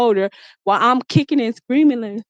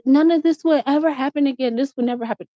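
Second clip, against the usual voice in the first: put-on apologetic boyfriend; 4 semitones higher than usual. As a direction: plead sorry in his voice. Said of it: "he's like".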